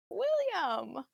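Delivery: name called out high and bright with surprise; surprised call. Acted. Mother perks up, greeting her son at the door, calling out. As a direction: perk up and call his name.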